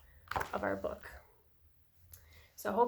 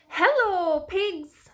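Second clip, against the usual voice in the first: high, loud, drawn-out greeting; Duck calling hello; 6 semitones higher, 6 dB louder, slower than usual. A narrator's reading. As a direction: call out as a storybook duck. Duck greets pigs in story.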